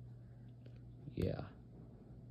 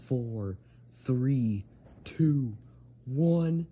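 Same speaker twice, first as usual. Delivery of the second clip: new year countdown; much slower than usual. Real, not acted. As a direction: count down with growing excitement.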